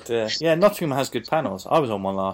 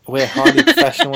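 mock pompous; 13 semitones higher, 7 dB louder than usual. Joking about the show's professionalism.

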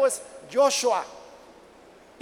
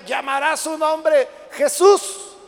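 half-speed, louder and higher declaration; emphatic proclamation; 4 semitones higher, 5 dB louder, much slower than usual. Acted. Angel naming Mary's son, proclaimed loud and slow. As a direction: herald the name, building to the last word.

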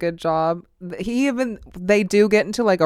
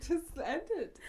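trying not to laugh; 10 semitones higher, 17 dB quieter than usual. Real, not acted. Laughing fit.

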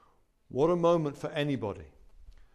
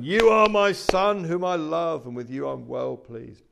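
voice of God; 3 semitones higher, 11 dB louder, slower than usual. Acted. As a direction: proclaim it slowly as the Father's voice from heaven. Preacher voices God's words again.

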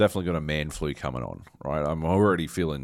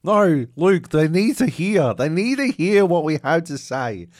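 much higher, louder mock-heroic voice; higher, louder mock-grand voice pleading with his co-host; hammed-up noble plea; 11 semitones higher, 8 dB louder than usual. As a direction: proclaim it like a martyr. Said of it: "And I'm like".

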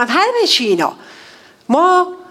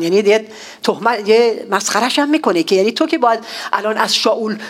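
normal talking voice

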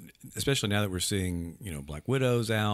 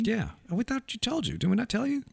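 mock surprise. Teasing friend left out.

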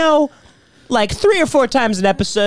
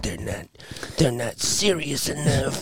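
quiet, slow fan complaint; mock disappointed fan; 4 semitones lower, 9 dB quieter than usual. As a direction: grumble like a let-down fan. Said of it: "he's like".

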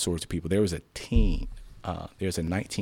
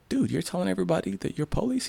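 much higher mock disbelief; high-pitched incredulous imitation; squeaky disbelief; 14 semitones higher than usual. Retold disbelief at cop.

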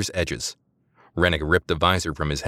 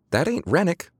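Eliza muttering; 12 semitones higher than usual. A narrator's reading. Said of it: "Eliza muttered".

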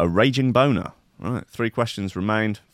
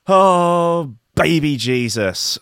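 startled exclamation; 6 semitones higher, 6 dB louder, much slower than usual. Real, not acted. Reacting to the video clip, a loud startled oh. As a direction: cry out in disbelief.

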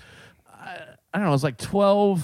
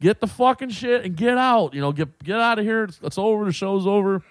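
raised, higher-pitched yell to clear the room; retold yell, pitched up; mock yelling; 7 semitones higher than usual. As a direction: imitate a man yelling everyone out. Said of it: "is, like, yelling".

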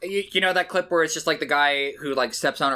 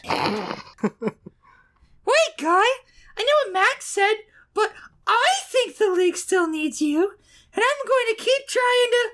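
man doing a woman's voice. Acted. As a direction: squeak it like a ditzy bimbo. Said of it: "I'll do the girl voice for her".

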